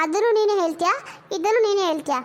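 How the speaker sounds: ordinary talk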